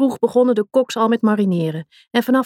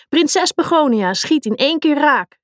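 playing commentator; 7 semitones higher than usual. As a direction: call the goal like a TV commentator. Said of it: "alsof ze de commentator van de wedstrijd was".

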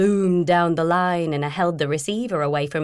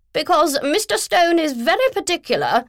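high, loud posh bellow; bellowing posh woman; 11 semitones higher, 5 dB louder than usual. Acted. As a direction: bellow it self-importantly. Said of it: "Philomena had been bellowing".

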